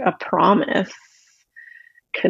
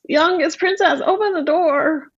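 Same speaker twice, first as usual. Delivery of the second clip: much higher pleading frog voice; frog crying out; 9 semitones higher than usual. Acted. Frog cries through the door demanding entry. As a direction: cry out high and insistent.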